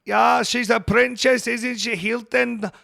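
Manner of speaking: high, loud, slow Liverpool Scouse voice; hammed-up Scouser mocking a wrestler; Scouse accent impression. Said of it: "That's what he sounds like".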